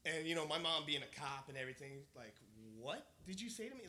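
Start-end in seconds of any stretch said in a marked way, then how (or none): 2.8-3.9 high-pitched, indignant challenge; mock outraged mother pulled up short; acted disbelief. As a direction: imitate an incredulous, offended mom. Mom acted as outraged cop.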